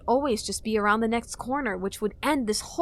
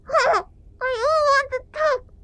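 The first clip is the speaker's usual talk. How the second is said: high, slow squeak; squeaky Deku voice; 8 dB louder, slower than usual. A narrator's reading. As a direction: incoherent squeaking scrub. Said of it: "squeaked Link incoherently".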